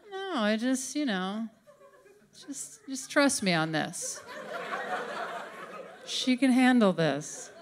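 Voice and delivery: calm them down in a hushed voice; God softly insisting to angels; quiet reassurance. Retold or imitated. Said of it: "God reassuring angels".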